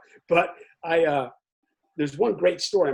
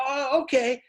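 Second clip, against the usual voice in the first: much higher, mock-startled okay; taken-aback acceptance; 11 semitones higher, 4 dB louder, slower than usual. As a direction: let the surprise pop out. Boys giving in to driver.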